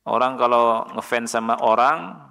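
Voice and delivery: ordinary talk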